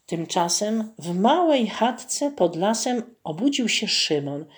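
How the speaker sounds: usual speaking voice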